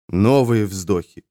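usual speaking voice

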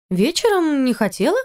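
put-on high, innocent tipsy girl; drunk girl voice. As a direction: answer like a drunk child.

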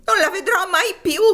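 high, loud, anguished sobbing; sobbing exclamation. Acted. Topsy cries that she'll never see Eva again, sobbing loudly. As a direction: wail it through tears. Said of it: "I suoi singhiozzi raddoppiarono".

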